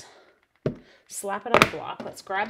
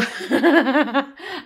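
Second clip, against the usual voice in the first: loud real laugh; 6 semitones higher, 12 dB louder than usual. Real, not acted. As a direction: burst out laughing. Laughs at her own mistake.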